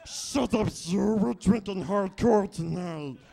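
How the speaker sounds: hammed-up angry creature, dragging the words; Schmiegel. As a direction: snarl it down at the timid side. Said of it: "a nice impression of Schmiegel".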